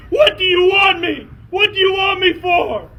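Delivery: high loud frightened yell; panicked shout. Acted. Jason yelling at the skunk horde in panic. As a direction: scream it up at the monster. Terrified boy yells at skunks.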